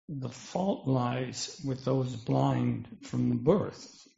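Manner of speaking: usual speaking voice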